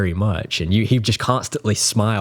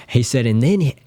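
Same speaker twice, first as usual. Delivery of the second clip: ordinary talk, nothing marked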